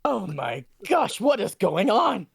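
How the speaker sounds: stunned by the commotion; alarmed. Acted. Professor reacting to chaos, slow high alarmed exclamation.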